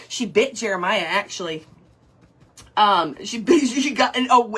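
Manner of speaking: normal talking voice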